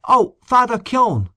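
high, slow, delighted surprise; surprised greeting. A narrator's reading. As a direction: greet him with a glad start. Host greets surprise visitor.

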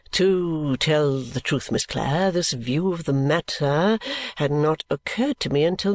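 defer fully to his wife's judgement; deferential Mr Badger.

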